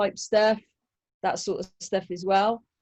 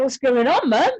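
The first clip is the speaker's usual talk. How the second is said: friend's puzzled question; 6 semitones higher, 7 dB louder than usual. Retold or imitated. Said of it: "he's like".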